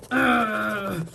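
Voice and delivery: a pained, strangled groan; letting the anger out in one groan; frustrated groan. Shown as a demonstration. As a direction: groan it out through clenched anger. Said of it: "and I just scream".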